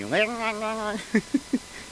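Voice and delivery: squeaky, high-pitched nonsense babble; mock high-pitched laughing-gas gibberish. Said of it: "makes your voice all high-pitched".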